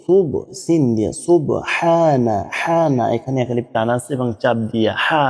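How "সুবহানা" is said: সুবহানা slow, with a stressed breathy ha. Shown as a demonstration. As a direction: hiss out the ha in সুবহানা. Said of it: "একদম ফ্যাস ফ্যাস একটা শব্দ হবে". (সুবহানা-য় ফ্যাসফ্যাসে হা চাপ দিয়ে)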